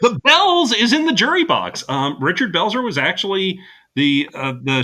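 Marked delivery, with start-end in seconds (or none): 0.0-1.8 high, loud excited exclamation; blurting it out with glee. Real, not acted. Excited about spotting Belzer.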